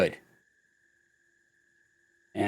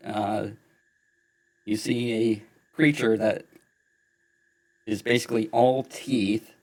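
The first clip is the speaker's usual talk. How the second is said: usual speaking voice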